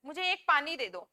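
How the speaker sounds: ordinary talk, nothing marked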